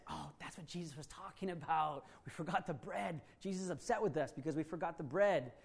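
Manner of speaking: soft, hushed realization; hushed worry. Acted. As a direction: murmur it so Jesus won't hear. Disciples realizing quietly.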